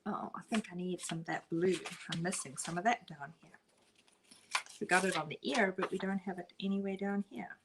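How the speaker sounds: ordinary talk, nothing marked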